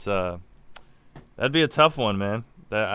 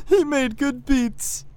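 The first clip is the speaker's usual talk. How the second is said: high, slow, wailing mock eulogy; mock tearful tribute, high and slow; mock mourner; 13 semitones higher, much slower than usual. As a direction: drag it out like a fake mourner. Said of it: "he was like".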